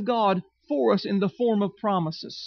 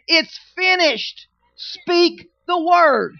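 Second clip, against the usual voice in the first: higher, louder and slow: It's finished; God's firm answer; 7 semitones higher, 5 dB louder, much slower than usual. Retold or imitated. God answering the whining church, loud and slow. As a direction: proclaim it down from heaven, firm and unhurried. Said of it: "he's up there saying".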